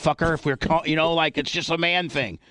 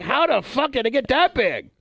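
high, amazed exclamation; mock amazement; 8 semitones higher than usual. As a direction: act stunned at the sight. Amazed at own erection.

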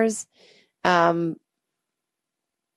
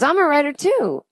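eager; 9 semitones higher, 3 dB louder than usual. Retold or imitated. Eager masseur.

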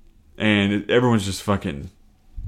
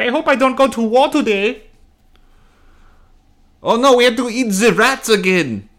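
put-on very high, failed German accent; bad German accent; 13 semitones higher, 4 dB louder than usual. Young German soldier dreading war, in a bad German accent. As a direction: try a German accent and miss it badly. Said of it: "That was not German".

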